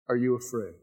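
normal talking voice

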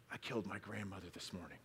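very soft, low murmur in the ear; retold whisper. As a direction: slip it out under your breath, imitating a secret. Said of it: "he'd whisper in their ears".

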